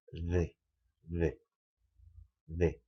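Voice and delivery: isolated "D" sound, repeated with pauses; sound demonstration. Shown as a demonstration. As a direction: show the sound of "D" as a model. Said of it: "la pronunciación tiene que ser como una". (demostración pausada del sonido "D")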